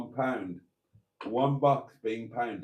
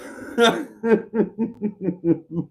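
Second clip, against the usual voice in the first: laugh; 9 semitones higher than usual. Real, not acted. Laughs at the voice note.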